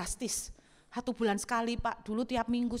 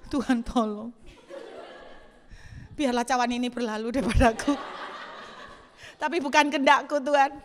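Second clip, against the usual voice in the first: pleading prayer; 2 semitones higher, 4 dB louder than usual. Retold or imitated. Mock prayer while forced to kneel.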